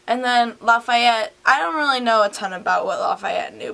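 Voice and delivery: ordinary talk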